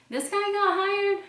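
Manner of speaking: stunned squeaky question; incredulous. Retold or imitated. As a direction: shriek it in mock disbelief. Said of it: "I'm like".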